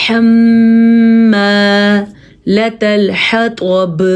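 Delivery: حَمَّالَةَ الْحَطَبِ slow with ح from its own place in the throat; Quran recitation. Shown as a demonstration. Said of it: "ہا کو اپنی جگہ سے نکالنا ہے".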